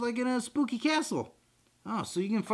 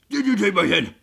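louder, mock-furious repeat; Rick's angry demand; 8 dB louder than usual. Rick confronts the zombie over his hand, loud mock anger. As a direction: demand an answer as a hammed-up Rick. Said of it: "He's like, "Zombie".

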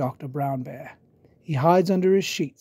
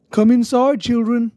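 calling out; 7 semitones higher, 6 dB louder than usual. Acted. Daddy Pig calling children indoors.

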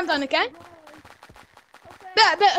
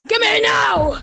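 shouted command; much slower than usual. Retold or imitated. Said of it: "it says".

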